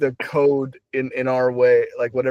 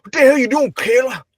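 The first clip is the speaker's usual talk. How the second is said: put-on much higher, loud old-man shout; grandpa yelling; 9 semitones higher, 4 dB louder than usual. Imagined grandpa yelling at Caleb, loud and high. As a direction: bark the name from the porch. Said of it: "Imagine your grandpa saying".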